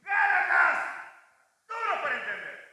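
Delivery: grito grave y luego voz aguda; Gálatas, y tras la coma una pausa larga; voz fuerte. Shown as a demonstration. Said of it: "alguien que dijo esto dijo así". (leer con pausa y grito como un apóstol que corrige)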